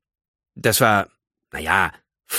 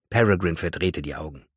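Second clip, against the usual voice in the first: ordinary delivery, nothing marked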